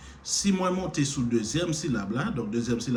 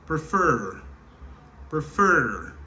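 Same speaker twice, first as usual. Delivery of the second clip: Prefer with FER louder and drawn out; stress on second syllable; 3 semitones higher, 3 dB louder, much slower than usual. Shown as a demonstration. Teacher showing learners which syllable rises, Prefer stressed on FER. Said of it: "Monter sous deuxième syllabe là".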